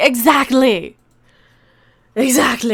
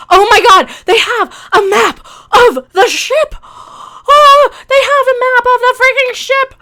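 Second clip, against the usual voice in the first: much louder, higher sarcastic gush; mock amazement; 7 semitones higher, 8 dB louder than usual. Reviewer sarcastically marvels at a ship map, loud mock amazement.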